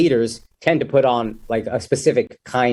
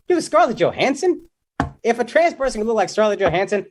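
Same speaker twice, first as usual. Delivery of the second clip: excited disbelief; 8 semitones higher than usual. Real, not acted. Excited joke build-up.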